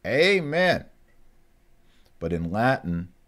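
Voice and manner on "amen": high, drawn-out Southern "Amen" with long ay; imitating a Baptist preacher's "Amen". Teacher mocks how Southern Baptists say it, drawn out. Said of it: "Baptists and Southerners say amen".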